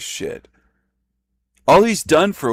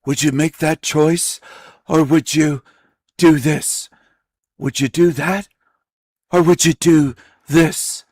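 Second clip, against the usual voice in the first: higher, hammed-up imitation of a melodramatic narrator; a mock-dramatic, slightly higher pleading read; mocking overdramatic actor; 4 semitones higher than usual. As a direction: overact it like a bad actor doing a big moral choice. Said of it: "Sounds like a bad actor".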